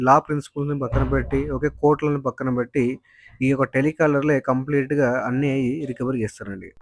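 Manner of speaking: loud, forceful warning to viewers; loud opening. Real, not acted. Vlogger warning viewers about illegal loan recovery, loud. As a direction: belt it out like a public alarm. Vlogger opens commentary loudly.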